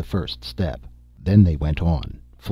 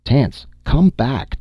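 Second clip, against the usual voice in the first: slow, high, louder call; shouting; 7 semitones higher, 4 dB louder, much slower than usual. A narrator's reading. Dorley calls Tance back from behind the gun, shouting. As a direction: call out across to someone out of sight. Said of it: "Dorley shouted".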